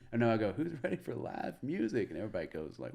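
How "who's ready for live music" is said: high-pitched imitation of a crowd warm-up; stage hype call. Comic warms up the crowd, acted high hype call.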